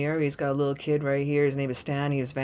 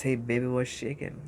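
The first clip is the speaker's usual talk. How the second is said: cool smooth-talker; 5 semitones lower than usual. Retold or imitated. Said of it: "the Emperor says".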